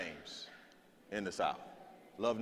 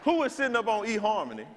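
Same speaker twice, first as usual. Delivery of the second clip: mock disbelief; 9 semitones higher than usual. Real, not acted. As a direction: act scandalized at the idea. Comic disbelief at devil's marriage.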